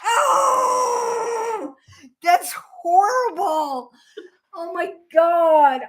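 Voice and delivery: laughing horror. Real, not acted. Horrified at herself to her friend, laughing wail.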